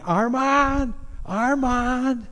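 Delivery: high, loud frantic calling; yelling a name. Retold or imitated. Father calling for his son in the rubble, frantic yell. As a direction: call out desperately for a lost son. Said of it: "and he's yelling".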